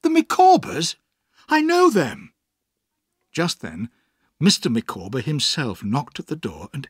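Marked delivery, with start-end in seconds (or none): 0.0-2.3 very high, loud exclamation; amazed, excited outcry; astonished recognition. Acted. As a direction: burst out on recognising the name. Amazed at hearing familiar name.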